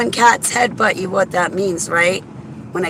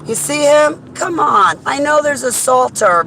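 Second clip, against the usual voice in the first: frustrated driver, higher pitched; exasperated; 6 semitones higher, 4 dB louder than usual. Real, not acted. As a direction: throw up your hands at the traffic. Stuck behind slow driver.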